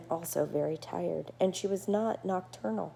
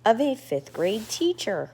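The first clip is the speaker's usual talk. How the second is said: usual speaking voice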